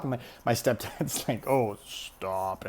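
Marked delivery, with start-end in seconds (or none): 1.5-2.7 low, sluggish imitation of a tipsy man; lowered boozy mock protest; drunk stepdad. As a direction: mimic a stepdad too drunk to react. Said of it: "he's a little, little drunk".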